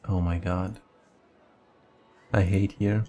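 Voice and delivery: ordinary talk, nothing marked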